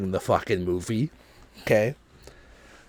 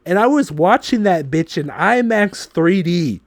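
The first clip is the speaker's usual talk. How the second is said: louder and higher, fed-up complaining; exasperated rant; 7 semitones higher, 8 dB louder than usual. Real, not acted. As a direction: vent about the lights like it still stings. Ranting about bright theater lights.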